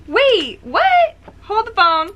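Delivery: loud, very high disbelief; shocked outburst. Real, not acted. Reader halting at the plot twist, shocked. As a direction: squeal it in shock, each bit its own burst.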